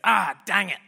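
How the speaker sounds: lightly voiced mate, "Ah, dang it" said in the speaker's own pitch with only a touch of extra punch; a casual grumbled "Ah, dang it" as the dad; mate's catchphrase. Dad hurts himself and mutters his catchphrase, lightly voiced. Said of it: "he goes".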